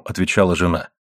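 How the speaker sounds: ordinary delivery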